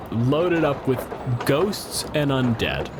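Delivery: usual speaking voice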